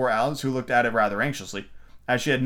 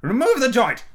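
very high, loud order; Red Queen ordering the waiters; royal command; 11 semitones higher, 6 dB louder than usual. A narrator's reading. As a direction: bark the order at the servants.

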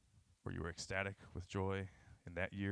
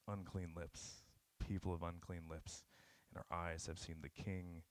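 hushed confession; 3 semitones lower, 10 dB quieter than usual. Acted. Scripture confession recited in awe, trailing softly.

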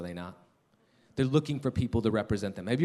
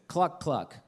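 short, higher-pitched clucking; imitated hen cluck; 5 semitones higher than usual. Father playing animal game with son, imitated cluck. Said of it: "make a series of noises".

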